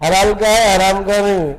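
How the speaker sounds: a blunt village man insisting, a bit loud; preacher voicing a blunt villager offering money to the cleric. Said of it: "وہ دیہاتی تو ایسے ہوتے، صاف صاف بولنے والے".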